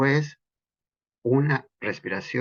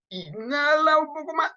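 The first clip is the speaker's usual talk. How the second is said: "inhala un poco más" called out much higher; coaching cue. Shown as a demonstration. Teacher urging students to inhale more, high call. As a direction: push for a bit more air, high and urging. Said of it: "pero un poco más, hay fuerza".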